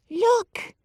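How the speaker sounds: put-on high, eager hushed boy; excited whisper. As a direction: point and whisper urgently. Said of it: "he whispered excitedly".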